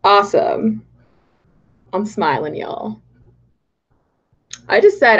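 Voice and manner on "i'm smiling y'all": a hint of Texan on y'all. Real, not acted. Streamer slipping into Texan while greeting chat. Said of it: "I said y'all very Texan".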